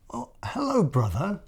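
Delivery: high chirpy little bird voice, friendly; cheerful high bird greeting; bird character voice. Acted. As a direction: greet him like a small bird. Bird's greeting in story.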